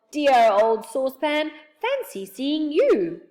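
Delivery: loud, slow, delighted welcome; amazed greeting. A narrator's reading. Friend greeting the old saucepan man, loud and amazed. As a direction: draw out each word in happy surprise. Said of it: "looked up in amazement".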